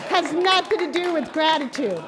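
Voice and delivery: high, loud, laughing through the words; can barely get it out for laughing. Real, not acted. Preacher cracks up at her own punchline, laughing aloud.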